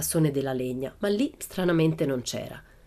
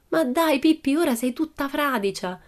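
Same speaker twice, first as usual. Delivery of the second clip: worried Annika; 9 semitones higher than usual. A narrator's reading. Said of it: "disse Annika preoccupata".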